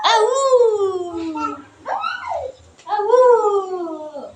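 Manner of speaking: drawn-out playful wolf howl. Pretend. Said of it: "Are you a baby wolf?". (mahabang pakunwaring alulong ng lobo)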